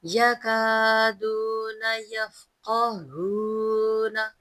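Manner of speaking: slow chanted verse with long vowels; tajweed recitation. Shown as a demonstration. Teacher reciting the verse for students, slow melodic chant. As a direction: chant each word slowly and melodiously. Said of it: "हा को भारी पढ़ेंगे".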